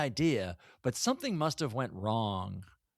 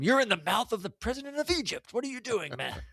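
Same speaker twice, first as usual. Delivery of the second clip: high-pitched, exasperated mock yell; imitated yell; 10 semitones higher than usual. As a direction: scold him in a strained, high mock shout. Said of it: "has to yell at him".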